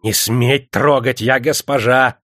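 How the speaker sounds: slow, loud, haughty warning; hateful shout. A narrator's reading. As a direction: forbid them loudly, full of hatred. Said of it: "с ненавистью крикнула заводчица". (крикнула с ненавистью)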